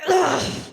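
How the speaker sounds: loud, high mock "ugh"; disgusted grunt. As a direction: imitate a pointed noise of disgust. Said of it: "she gives him one of these".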